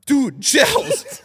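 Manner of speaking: loud, high-pitched exclamation to a friend; excited call. Retold or imitated. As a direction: burst out to your buddy, reliving it.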